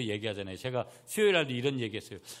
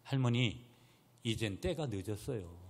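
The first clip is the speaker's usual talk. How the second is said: put-on reply as a child drained of energy; listless answer; 4 dB quieter than usual. Granddaughter answering grandmother too late, imitated listless reply. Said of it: "힘없이 대답을 했다".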